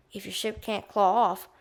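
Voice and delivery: ordinary delivery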